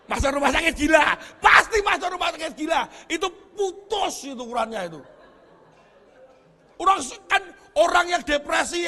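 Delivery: loud, driving sermon voice; fiery preaching. Acted. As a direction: preach it out at full force. Preacher in full force.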